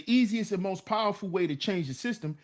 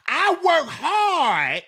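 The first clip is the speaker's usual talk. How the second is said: high, slow, drawn-out mock complaint; hammed-up aggrieved wail; whining like their mamas; 6 semitones higher, 4 dB louder, much slower than usual. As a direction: mimic grown men griping like mothers. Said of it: "and how they say it".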